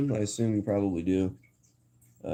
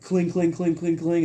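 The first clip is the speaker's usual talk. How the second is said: high, rapid-fire ringing noise; imitated clanging; 8 semitones higher, slower than usual. Streamer mimicking a meme's sound effect, high repeated clangs. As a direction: mimic a bowl clattering on the floor. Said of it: "the volume is up all the way".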